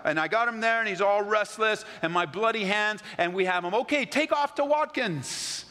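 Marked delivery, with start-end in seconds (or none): 3.7-5.7 higher, slow sending-off call. Pretend. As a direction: shoo it off with a long call. Sending the goat off.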